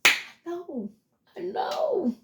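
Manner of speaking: squeaky high-pitched agreeing; high sing-song I know; high mock agreement. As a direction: chirp it way up high, humoring her. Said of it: "I'm like".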